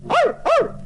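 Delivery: high dog barks. A narrator's reading. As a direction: bark like a small dog. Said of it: "barked the dog".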